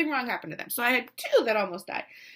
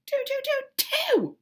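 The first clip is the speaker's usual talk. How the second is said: high, strained squeak on a word; mock choking up. Mother breaking down at page two, mock choked up. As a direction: voice climbs as tears take over.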